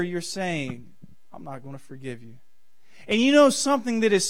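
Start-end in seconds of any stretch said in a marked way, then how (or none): 1.3-2.3 low, quick, very soft aside; quiet refusal. Acted. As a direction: drop right down for the unforgiving thought. Voicing a bitter person's inner refusal.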